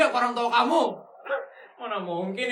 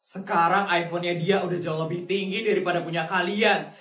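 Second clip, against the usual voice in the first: kind rich aunt; 6 semitones lower than usual. Acted. Aunt scolds bullies.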